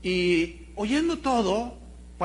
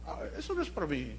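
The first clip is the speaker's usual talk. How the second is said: softer, quick mocking brush-off; mock dismissive; 6 semitones lower, 8 dB quieter than usual. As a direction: imitate someone shrugging off the message.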